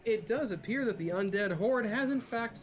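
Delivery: high-pitched, formal train announcer. Acted. Game master as PA announcer to passengers, high formal announcement. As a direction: announce disaster with official politeness. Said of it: "another hail over the P A system".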